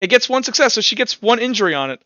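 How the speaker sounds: normal talking voice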